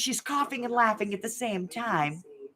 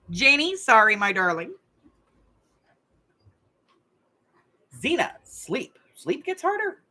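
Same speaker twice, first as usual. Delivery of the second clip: game show host. Acted. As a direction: play the TV host reading out the scores. Hosts a Family Feud style game.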